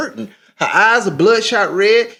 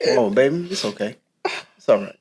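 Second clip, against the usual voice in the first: comforting; 8 semitones lower, 5 dB quieter, faster than usual. Real, not acted. Husband comforting crying wife.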